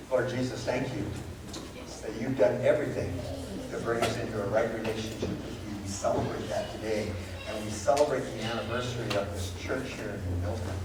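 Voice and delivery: prayer. Acted. Pastor leads the church in closing prayer. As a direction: pray quietly and reverently.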